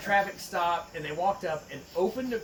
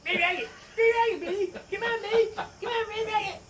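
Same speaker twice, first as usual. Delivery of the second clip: high, squeaky cartoon kid voice, much higher; sing-song Cartman crooning red rocket; Cartman impression; 13 semitones higher than usual. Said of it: "I'm just thinking of Cartman".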